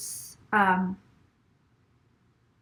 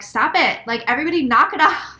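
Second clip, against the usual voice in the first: exasperated scolding; 6 semitones higher, 6 dB louder than usual. Retold or imitated. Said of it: "and they're like".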